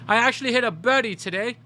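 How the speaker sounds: hammed-up aristocrat boasting; a pretend rich golfer, high; mock posh golfer.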